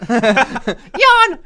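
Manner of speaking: loud, very high yawn; high character voice. Acted. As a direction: chirp yawn as the mom. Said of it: "and my mom said".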